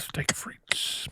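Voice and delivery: soft whispered echo; whispering. Pretend. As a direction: whisper it back. Echoing whispered order.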